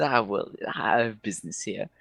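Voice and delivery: high, probing elf voice; high-pitched, hesitant character questioning; flamboyant elf. Acted. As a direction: inquire delicately as a theatrical elf. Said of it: "He says".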